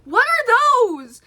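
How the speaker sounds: high, loud, stretched-out disbelief; incredulous outcry. Retold or imitated. Pointing out weird dots to mom, incredulous outcry. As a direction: cry it out in exaggerated bafflement.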